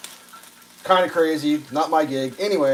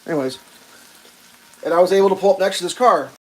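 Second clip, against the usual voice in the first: ordinary talk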